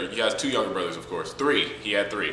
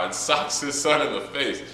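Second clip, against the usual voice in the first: ordinary talk, nothing marked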